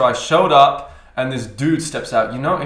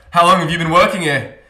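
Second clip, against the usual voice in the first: customer; 4 semitones higher, 9 dB louder than usual. Retold or imitated. Customer voiced in story.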